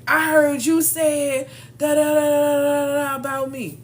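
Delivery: hammed-up confronting a gossiper; mock confrontation. Mocking a confrontation.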